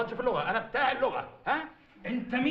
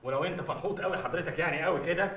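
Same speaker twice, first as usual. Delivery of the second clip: ordinary talk, nothing marked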